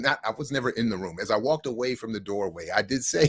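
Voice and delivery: usual speaking voice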